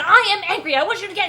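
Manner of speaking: high, loud mock fury; angry Harry. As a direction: cut in angrily before he can finish. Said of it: "it's an angry voice on the other end".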